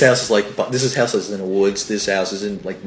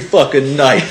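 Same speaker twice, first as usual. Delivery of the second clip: ordinary talk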